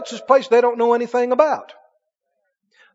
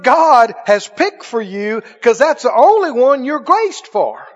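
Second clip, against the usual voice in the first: preaching loud; 3 semitones higher, 5 dB louder than usual. Acted. Preaching climax.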